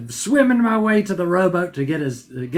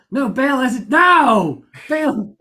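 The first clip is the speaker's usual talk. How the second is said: exasperated shouting; 6 semitones higher, much slower than usual. Retold or imitated. Acted quarrel over misheard order.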